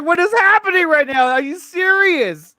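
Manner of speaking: high-pitched incredulous wail; mock shock. Recounting shock at the movie, high-pitched disbelief. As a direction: protest in exaggerated, high disbelief. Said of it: "I was in shock".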